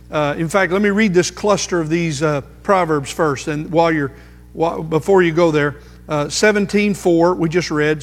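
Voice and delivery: usual speaking voice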